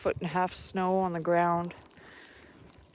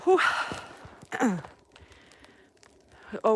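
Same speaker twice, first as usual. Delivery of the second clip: usual speaking voice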